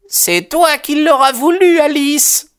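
very high, agitated cry; exclaimed reproach. A narrator's reading. Mother giving in and blaming Alice, crying out. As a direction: cry it out at her, pitched right up. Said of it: "s'écria la mère".

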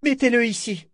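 high-pitched urgent command; pressing high call; shouted order. A narrator's reading. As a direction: cry out to the crew, voice way up. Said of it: "cria-t-elle".

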